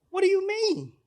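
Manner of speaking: high-pitched mock disbelief; imitated indignant protest. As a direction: protest high, as the thwarted son. Voices son protesting to guard.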